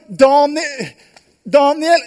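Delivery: high, loud calls; calling out. Acted. The worried king calls for Daniel, loud. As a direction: call down into the den. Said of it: "Så roper han".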